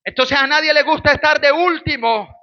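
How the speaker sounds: ordinary talk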